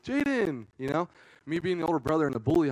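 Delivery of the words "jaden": sing-song high call of her name; calling sister. Retold or imitated. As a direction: lure her in with a sweet call.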